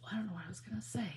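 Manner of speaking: whispered aside; quick whispered aside. Real, not acted. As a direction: breathe it under your breath. Whispering while working.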